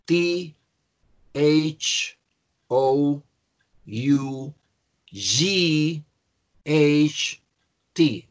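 one letter at a time, long pauses; careful letter-by-letter dictation; spelling aloud. Shown as a demonstration. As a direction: spell it slowly for a class. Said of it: "Pero el spelling aún es más enrevesado".

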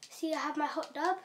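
ordinary talk

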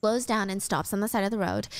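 ordinary talk

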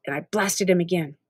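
plain reading voice

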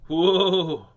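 loud, drawn-out whoa; excited whoa. Acted. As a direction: let out a big whirling whoa. Manta ray whirling in story.

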